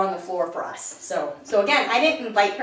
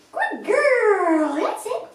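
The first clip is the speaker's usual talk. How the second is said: much higher, louder praise for the puppy; excited high dog-praise voice; 13 semitones higher, 6 dB louder, much slower than usual. Real, not acted.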